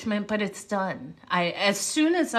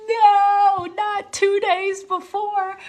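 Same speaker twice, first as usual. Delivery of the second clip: much higher and louder, wailing no no; retold panic; 7 dB louder, slower than usual. Recalling her panic before the TED talk, high wailing protest. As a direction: panic about losing the voice right before the talk. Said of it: "And I said".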